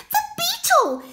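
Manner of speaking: very high, loud squeak; squeaky hedgehog. Acted. Hedgehog spotting the beetle, high excited squeak. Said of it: "he squeaked".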